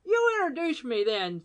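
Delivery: high, bright-eyed fussy caller; pompous gentleman voice. Acted. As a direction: play the chatty intruding gent. Badger pressing.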